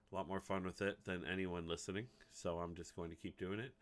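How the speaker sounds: ordinary talk, nothing marked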